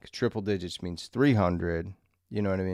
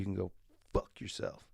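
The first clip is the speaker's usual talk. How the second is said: whispered aside; 9 dB quieter than usual. Real, not acted. Snapping at judgmental viewers.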